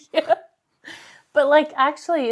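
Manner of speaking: laughing, high-pitched disbelief. Real, not acted.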